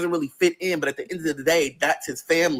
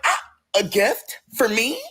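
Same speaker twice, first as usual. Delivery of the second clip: mock surprised delight; 7 semitones higher, slower than usual. Receiving a gift.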